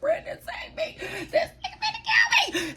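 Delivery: very high, squealed cry for help with a laugh; mock desperate plea. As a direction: squeal in hammed-up panic. Mock plea to boyfriend for rescue.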